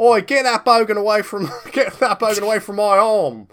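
loud, high Australian yell; Australian accent. Acted. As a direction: bark it like an angry Aussie boss. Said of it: "let me do Australian".

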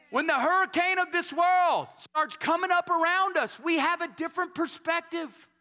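high, loud, ringing preacher's cry to the congregation; full-force preaching. Acted. Pastor rallying the congregation after the hurricane story, full-force preaching. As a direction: lift the church with each repeated line.

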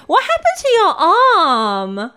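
put-on high, loud, slow kid's question; a child staring at a missing arm, asking loudly; curious kid blurting. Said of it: "and is like".

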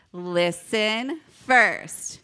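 very slow, drawn-out call-and-response; leading group repeat. Shown as a demonstration. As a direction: stretch out each word so everyone joins. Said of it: "Let's try one more time".

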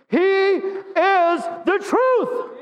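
high, very drawn-out preacher's cry; rallying declaration. Real, not acted. Preacher has congregation say it with him, high rallying cry. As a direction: stretch every word out high for the crowd.

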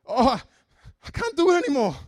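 put-on high, loud Indian accent complaint. Coworker giving up on reading the Bible, Indian accent. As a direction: moan it in the friend's Indian accent. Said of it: "With a very pronounced Indian accent".

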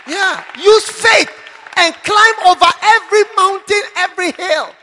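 loud, rousing preacher's shout; full-voiced call to climb every mountain; fiery preaching. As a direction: preach it to the back row.

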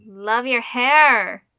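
loud, drawn-out compliment; emphatic, stretched-out delight; gushing admiration. Real, not acted. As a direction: gush slowly over a friend's haircut. Gushing over friend's new haircut.

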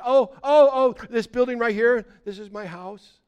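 high and loud, bursting with pride in his house; excited castaway. Acted. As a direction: show off the house like a giddy child. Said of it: "He was so excited about it".

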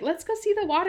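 mock high voice; imitating Charlie. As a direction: pipe it up brightly to pull a friend away. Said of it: "Charlie's like".

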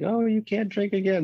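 high-pitched little angel voice; angel on shoulder. Acted. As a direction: plead from the shoulder as the good conscience. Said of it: "the angel's like".

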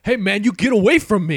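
high, loud panicked yell; panicked shout. Acted. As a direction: shove him off in alarm. Acted scene, friend refusing.

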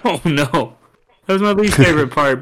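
usual speaking voice

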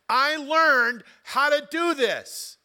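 loud, high and slow, leaning on learned; emphatic quote. Acted. Pastor booming Paul's words, loud and slow. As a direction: proclaim Paul's words like a testimony. Quoting Paul with force.

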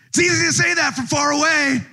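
yelled comeback. Retold or imitated. Officer yelling back at the heckler, high childish taunt. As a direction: fire it back at him, childish. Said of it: "And I yelled at him".

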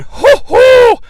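very high, loud, drawn-out "hoo-hoo"; falsetto-high announcing hoot; hammed-up whoop. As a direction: whoop it slowly like a theatrical entrance. Whoops as thunder god arrives.

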